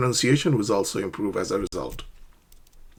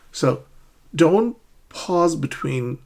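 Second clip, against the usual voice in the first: normal talking voice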